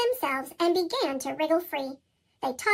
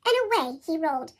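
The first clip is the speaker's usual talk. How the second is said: usual speaking voice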